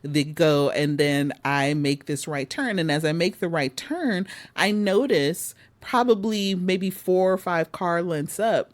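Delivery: usual speaking voice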